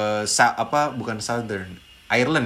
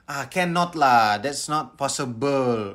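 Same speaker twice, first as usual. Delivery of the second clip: put-on slow, drawn-out Singlish accent; Singaporean English; much slower than usual. Son imitating dad refusing, Singaporean English. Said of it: "bokap gue itu Inggrisnya Inggris Singapura".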